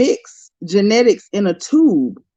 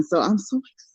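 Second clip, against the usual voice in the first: breathy excited whisper; hushed, giddy whisper; 8 dB quieter than usual. Real, not acted. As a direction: whisper it like a secret thrill.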